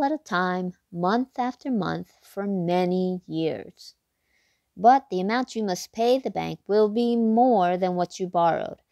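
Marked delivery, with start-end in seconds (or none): none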